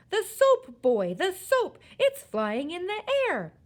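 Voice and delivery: high, loud frantic explanation; agitated father yelling at his boy; amazed shouting. A narrator's reading. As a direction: shout it, flabbergasted.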